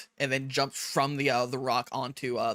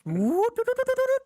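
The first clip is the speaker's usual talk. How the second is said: high sing-song cricket chirps; cricket tune; 5 dB louder than usual. Retold or imitated. Game master imitating a cricket for the players, high sing-song. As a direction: hum it like a little cricket. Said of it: "A cricket tune nearby goes".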